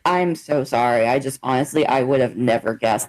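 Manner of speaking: imitating the interviewee. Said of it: "And she was like".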